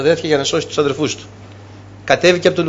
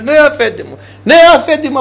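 bowing servants; 9 semitones higher than usual. Retold or imitated. Brothers bowing before Joseph.

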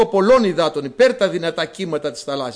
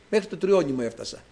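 ordinary delivery

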